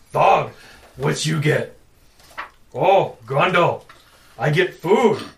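loud, slow brutish giant; dumb giant voice. Acted. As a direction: boom it out like a dim giant.

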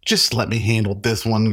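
ordinary talk, nothing marked

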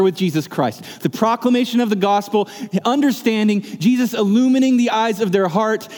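high, fervent preaching that builds; full-force preaching. Acted. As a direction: rouse the congregation, voice lifted. Preaching climax, raised voice.

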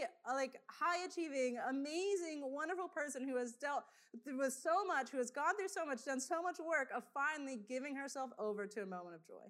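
ordinary talk, nothing marked

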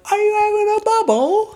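chirpy hammed-up imitation of young Brits; mock British youth. Host imitates British twenty-somethings on the game show. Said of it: "if you're having a laugh".

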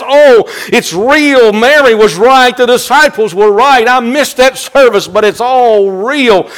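high, loud cries of realization; excited astonishment. Acted. Preacher as Thomas finding it real, excited astonishment. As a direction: proclaim it in overwhelmed awe. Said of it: "the awe in his voice as he says".

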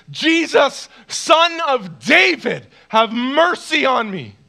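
acted desperate cry, high, loud and slow; desperate scream. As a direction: cry out from the depths, drawing out each phrase. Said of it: "starts to scream from the depths of everything".

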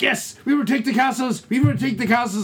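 high, hammed-up German accent; mock Nazi German. Co-host acting out German soldiers seizing castles, mock German accent. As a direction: chant it like a cartoon German soldier. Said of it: "when the Nazi Germans were in there".

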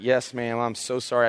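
a gentle answer to calm her; gentle apology. Retold or imitated. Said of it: "I was trying to be as nice as possible".

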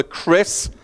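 normal talking voice

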